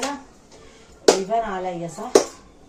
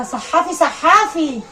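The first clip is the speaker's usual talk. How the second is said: mock loud call; 7 semitones higher, 9 dB louder, much slower than usual. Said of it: "واحده قالت لها".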